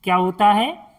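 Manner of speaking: ordinary delivery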